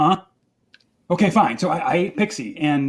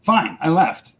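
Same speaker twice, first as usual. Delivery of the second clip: ordinary delivery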